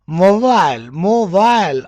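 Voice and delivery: MOBILE as "MO-vile", long i; full "eye" vowel in MOBILE; British pronunciation. Shown as a demonstration. Teacher modelling mobile against the American, ending "vile". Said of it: "mobile in American".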